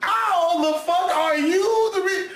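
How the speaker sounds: high, slow, laughing rant; laughing disbelief. Real, not acted. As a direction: laugh through an outraged rant. Mocking the killer's plan.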